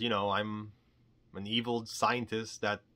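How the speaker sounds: ordinary talk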